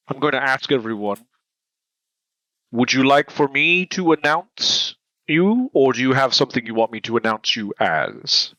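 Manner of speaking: normal talking voice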